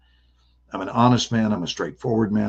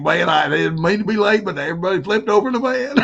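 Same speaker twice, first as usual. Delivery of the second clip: high, louder imitation of a laughing buddy; buddy half laughing; 8 semitones higher, 4 dB louder than usual. Buddy retells the crash to people, imitated high laughing voice. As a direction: imitate a friend tickled by his own story. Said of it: "And then he's laughing".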